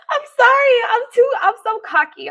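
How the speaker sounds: high, loud, gleeful wail; giddy apology. Real, not acted. Streamer to viewers, cocky after compliment, giddy wail. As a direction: apologise while bursting with self-delight. Apologising while overcome with glee.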